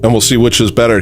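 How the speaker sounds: normal talking voice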